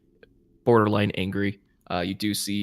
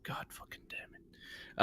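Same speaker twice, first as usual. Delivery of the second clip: hissed curse under his breath; exasperated whisper; 20 dB quieter than usual. Acted. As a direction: whisper it in frustration. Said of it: "He's like".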